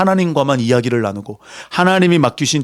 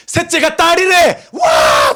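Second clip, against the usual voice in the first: much higher, louder cheering shout; joyful shout; 6 dB louder, much slower than usual. Acted. A parent cheering that the third child is a girl, joyful shout. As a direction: shout the good news.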